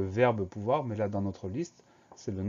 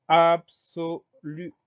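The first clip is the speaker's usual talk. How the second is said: ABSOLU drawn out, higher and louder; slow dictation; 6 semitones higher, 7 dB louder, much slower than usual. Shown as a demonstration. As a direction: dictate "absolu" slowly, syllable by syllable. Teacher dictates the word for spelling.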